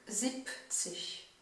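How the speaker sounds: "siebzig" very slowly, the B said like a P; slow demo. Shown as a demonstration. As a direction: stretch "siebzig", say "sip-zig". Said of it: "il se prononce plus comme un P". (« siebzig » très lentement, B prononcé P)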